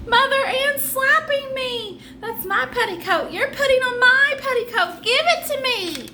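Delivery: a slapped twin calling to mother; whiny angry child. Acted. Twin cries out after slap.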